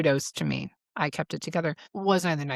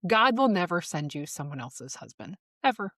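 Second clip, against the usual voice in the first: normal talking voice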